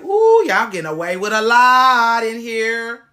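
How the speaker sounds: loud, slow knowing accusation; drawn-out sassy call-out. Retold or imitated. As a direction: stretch the ooh like you caught them. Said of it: "I be like".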